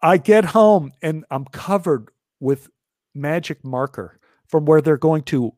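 ordinary talk

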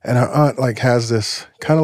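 ordinary talk

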